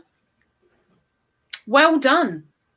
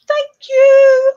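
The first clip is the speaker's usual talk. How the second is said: high squeal; 10 dB louder than usual. Real, not acted. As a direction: thank you squealed high and loud.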